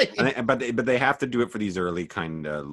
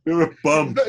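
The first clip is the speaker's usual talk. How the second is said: mock dad insult; 8 semitones higher, 6 dB louder than usual.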